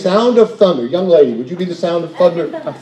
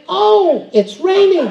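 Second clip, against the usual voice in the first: mock surprise; 13 semitones higher, much slower than usual. Friend fooled by spit.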